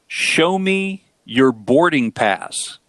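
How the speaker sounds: drawn-out, very clear delivery; slow, deliberate. Retold or imitated. Wife correcting husband on the plane, slow and deliberate. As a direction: spell it out slowly, word by word. Wife spells out misheard phrase.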